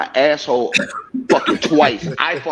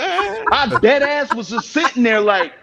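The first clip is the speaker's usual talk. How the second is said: high laughing recall; 7 semitones higher than usual. Retold or imitated. Acts out hiding under blanket.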